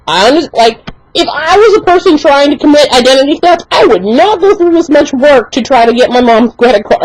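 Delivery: ordinary talk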